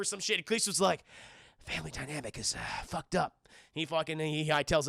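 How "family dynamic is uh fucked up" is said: low, soft, understated mutter; acted quiet, laconic remark; deadpan aside. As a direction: drop low and murmur it dryly. Blondie's deadpan aside on the fight.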